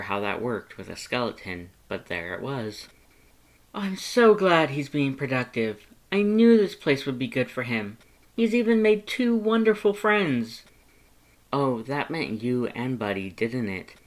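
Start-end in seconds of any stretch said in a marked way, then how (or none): 3.7-10.6 higher, louder beaming pride; glowing about his brother; proud Papyrus. Acted.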